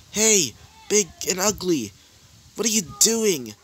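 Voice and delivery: taunting call-out. Acted. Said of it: "one of them would say this".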